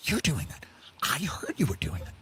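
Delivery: hushed conspiratorial whisper; whispered gossip. Acted. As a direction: murmur it like insider gossip. Gossiping rich voices.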